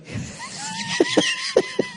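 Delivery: high shrieking imitated crowd laugh; imitating crowd laughter; mock crowd laughter. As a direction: squeal like a roaring crowd.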